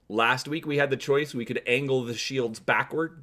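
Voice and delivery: usual speaking voice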